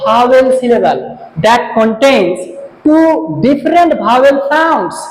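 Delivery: very slow, each phrase spaced out; slow dictation. Shown as a demonstration. Teacher reading a definition aloud for students to write, very slow. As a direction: read the definition slowly for note-taking. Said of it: "তোমাদেরকে আমি এর সংজ্ঞাটা বলে দিচ্ছি".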